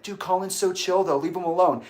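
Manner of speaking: dismissive defending. Retold or imitated. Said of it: "somebody today was like".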